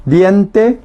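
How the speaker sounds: ordinary talk, nothing marked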